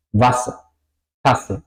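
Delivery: short clipped A, long S in "Wasser, Tasse"; vocal corta. Shown as a demonstration. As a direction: keep the A brief in "Wasser, Tasse". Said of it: "suena corta". ("Wasser, Tasse" con a corta y ese larga)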